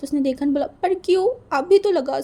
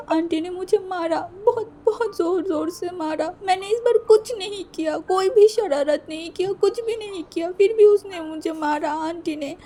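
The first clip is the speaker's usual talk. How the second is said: put-on high, tearful child's voice pleading; crying little boy; 7 semitones higher than usual. Hurt boy crying to Gege about auntie's beating, high tearful plea. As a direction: sob it out like a hurt eleven-year-old begging. Boy complains of being beaten.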